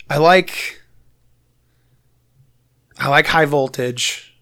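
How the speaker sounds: ordinary delivery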